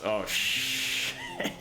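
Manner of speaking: high startled squeal; shocked at a sudden drop; surprised. Real, not acted. Horse falls off cliff.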